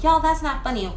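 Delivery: high, loud panicked shout; frightened yell. Retold or imitated. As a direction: yell it down the stairs, scared. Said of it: "I'm yelling downstairs".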